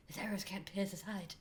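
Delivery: soft breathy whisper. Pretend. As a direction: breathe it like the sexy elf lady. Voicing Evangeline Lilly's elf.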